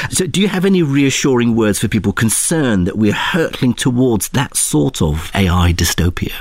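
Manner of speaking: normal talking voice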